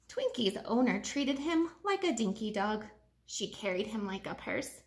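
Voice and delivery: ordinary delivery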